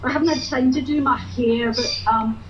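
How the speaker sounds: put-on broad Glasgow Gorbals accent; cheeky Glaswegian woman. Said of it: "This is Wee Jenny from the Gorbals".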